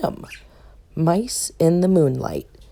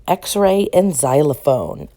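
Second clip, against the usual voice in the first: plain reading voice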